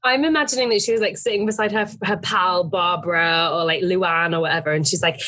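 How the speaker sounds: normal talking voice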